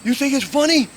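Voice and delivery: high, loud indignant challenge; mock a furious player, voice shooting up; angry challenge. Fallen tennis player confronts the man who laughed, angry challenge.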